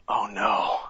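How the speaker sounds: soft, broken, near tears; a quiet crying gasp at the loss; choked-up oh no. Retold or imitated. Joel sees his daughter shot, soft crying gasp.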